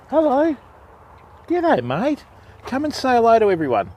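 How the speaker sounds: high, sweet greeting to the dog; high dog-talk voice. Real, not acted. Fisherman greeting the farm dog, high baby-talk voice.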